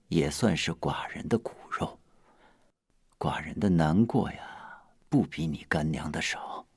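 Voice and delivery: low, soft, heavy-hearted confession; sorrowful. Acted. King confiding grief over his child, sorrowful. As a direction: sink into quiet grief.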